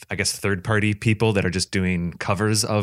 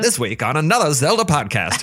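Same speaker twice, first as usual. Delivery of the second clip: radio host voice; 6 semitones higher, 3 dB louder than usual. Pretend. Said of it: "news radio host kind of".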